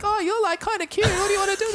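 put-on very high-pitched girly voice.

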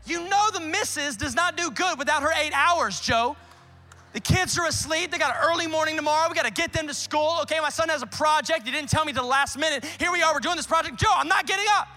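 high, loud exasperated yell; shouting at trespasser. Acted. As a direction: shout him off the porch. Homeowner yelling from bed.

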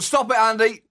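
ordinary talk, nothing marked

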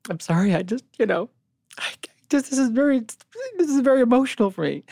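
high, squeaky crying voice; hammed-up weepy squeak; mock tearful. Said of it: "crying with the nitrous oxide".

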